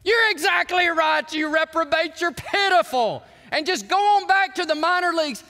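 harsh, high, shouted dressing-down; imitated manager's berating shout; Leo Durocher. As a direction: yell it like a merciless old-school coach. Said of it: "the old Leo Durocher would have said".

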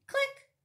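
high, quick clicking sound; little high-pitched camera click. A narrator's reading. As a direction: chirp a tiny shutter click.

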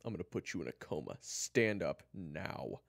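threaten, then stretch out the order; angry threat. Acted. Kyler threatens Eric and orders him up, angry.

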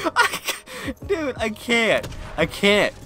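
high, slow, pleading whine; whiny despair. Real, not acted. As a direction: moan it out as if near tears. Giving up on broken ladders.

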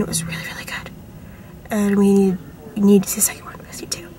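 unvoiced hushed aside; whispering. Real, not acted. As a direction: whisper it to the camera. Whispered movie plans.

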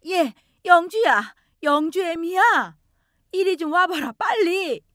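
high, slow urgent call from another room. Acted. Mother-in-law calling her daughter-in-law loudly from the room. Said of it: "큰 소리로 저를 부르기".

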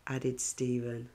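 plain reading voice

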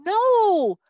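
high, loud cry of disbelief; protesting king. Acted. Preacher voices the king refusing Jerusalem's fall, protesting in disbelief. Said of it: "from the king's point of view going".

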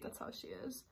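whispered joking aside; teasing whisper. Real, not acted. Vlogger whispering a tease about her friend. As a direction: hush it like a secret tease.

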